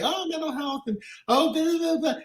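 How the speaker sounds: high-pitched mock of people scoffing; dismissive mockery. Imitating people who wave off mental health, high mocking dismissal.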